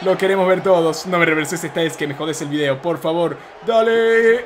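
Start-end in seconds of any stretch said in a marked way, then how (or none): none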